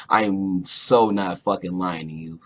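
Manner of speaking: ordinary delivery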